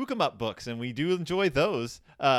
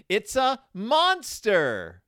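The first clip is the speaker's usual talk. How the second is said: high, slow dramatic outcry; cried out; 6 semitones higher, 4 dB louder, much slower than usual. A narrator's reading. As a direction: draw out the monster alarm. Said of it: "cried Sister".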